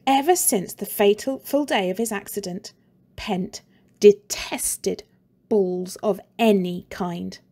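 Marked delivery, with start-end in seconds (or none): none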